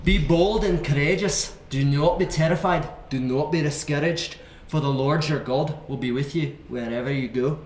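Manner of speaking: put-on Scottish accent for reading scripture; amateur Scottish accent. Shown as a demonstration. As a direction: read it out like a Scot. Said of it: "I'll do a Scottish accent".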